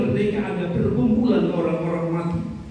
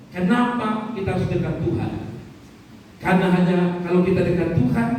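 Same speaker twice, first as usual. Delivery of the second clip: ordinary delivery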